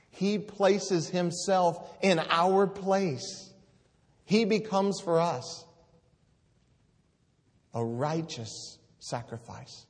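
preacher lifts his voice over the priest's sacrifice; preaching at full force. Acted. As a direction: build to a crying-out pulpit climax. Preaching climax.

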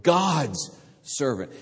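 "God's servant" said slowly, "God's" leaned on; underlined, slow. Shown as a demonstration. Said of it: "Underline it".

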